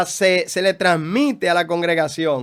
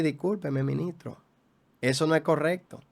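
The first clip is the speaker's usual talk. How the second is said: quiet, respectful doctor correcting the pastor; soft-spoken doctor politely disagreeing; polite correction; 8 dB quieter than usual. Retold or imitated. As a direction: gently point out the mistake, voice down.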